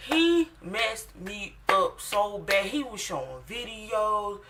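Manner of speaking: slow and loud, reliving the fright; emphatic loud. Real, not acted. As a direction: hammer home how scary it was. Emphatic peak of the church story.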